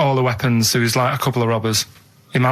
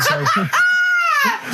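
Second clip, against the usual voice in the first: laughing. Real, not acted. Laughing outburst mid-story.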